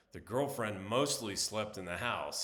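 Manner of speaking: ordinary talk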